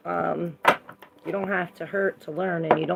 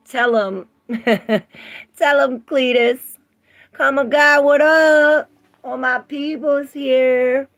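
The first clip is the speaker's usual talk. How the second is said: hyped greeting; 7 semitones higher, 9 dB louder than usual. Real, not acted.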